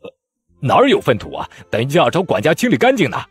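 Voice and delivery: high, loud flustered voice. A narrator's reading. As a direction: blurt it out in a fluster. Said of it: "慌道".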